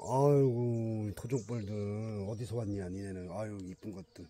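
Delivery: slow, soft cooing to the bees; tender cooing. Real, not acted. Beekeeper cooing softly to the bees he waited for, tender and slow. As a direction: murmur fondly over them like babies.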